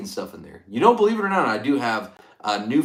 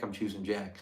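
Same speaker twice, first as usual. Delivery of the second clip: usual speaking voice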